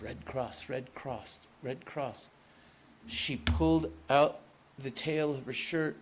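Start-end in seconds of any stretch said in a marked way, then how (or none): 0.0-2.3 murmured under her breath, softer; steady yourself with a quiet chant; softly to herself. Acted. Nurse repeats her role to herself, soft murmur. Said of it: "she told herself".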